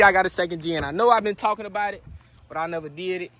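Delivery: ordinary delivery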